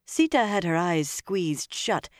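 usual speaking voice

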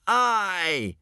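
high bellowed cheer. A narrator's reading. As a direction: roar it as the whole tribe. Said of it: "bellowed out every member".

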